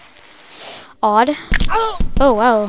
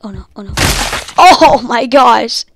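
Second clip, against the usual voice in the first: normal talking voice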